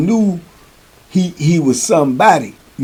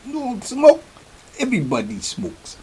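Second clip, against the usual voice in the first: put-on incredulous African accent, questions pitched way up; Chief; 11 semitones higher, 6 dB quieter than usual. Chief can't believe he doesn't smoke, high imitated African accent. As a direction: imitate a disbelieving man with a thick African accent. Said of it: "he had this thick accent".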